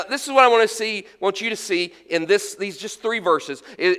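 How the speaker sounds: normal talking voice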